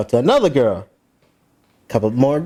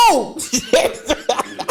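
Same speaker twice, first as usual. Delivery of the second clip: high-pitched "oh" through laughter; shocked reaction. Retold or imitated. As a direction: replay stunned amazement, laughing. Acted shock at seeing slap.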